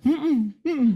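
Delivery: high nasal-free hum of agreement; mock high humming. Wife knowingly agreeing with the pastor, mock high humming.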